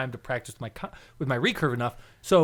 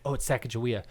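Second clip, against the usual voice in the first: discreet reply; 4 semitones lower, 7 dB quieter, faster than usual. Retold or imitated. Coworker supplies the name quietly.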